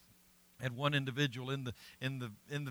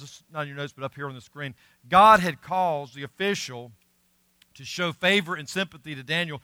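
usual speaking voice

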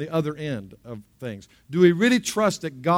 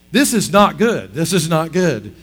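mock alarm; 7 dB louder than usual. Acted worried thought in alligator water.

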